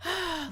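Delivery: heavy exhale to collect yourself; sigh. Retold or imitated. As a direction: let out an audible breath before speaking. Collecting herself while freaking out.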